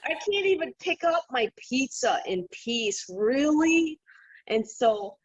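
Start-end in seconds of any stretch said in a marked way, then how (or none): 0.1-4.0 higher-pitched, laughing exasperation; half-laughing, fed up with being interrupted; exasperated. Retold or imitated. Medium at a restaurant, retelling her reaction to a waving spirit, laughing exasperation. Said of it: "and I was just like".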